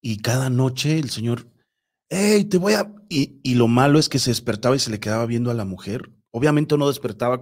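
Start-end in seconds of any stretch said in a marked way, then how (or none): 2.1-2.9 high, sudden retold threat; mock yell. Storyteller voicing the husband at night, mock yell. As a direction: imitate a sleeper yelling out, cut short. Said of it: "empezaba a gritar".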